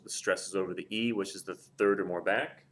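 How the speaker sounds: ordinary delivery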